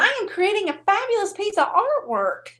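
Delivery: high-pitched, playful protest; mock indignation. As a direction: pitch it up, grandly affronted. Mock indignation at being questioned.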